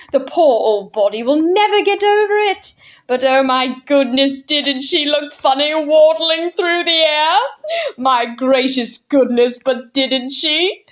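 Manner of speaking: high, slowed, wheezing with amusement; amused old man, giggly. Acted. Mister Wig recalling Miss Persimmon's waddle, laughing. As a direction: barely hold in the laughter.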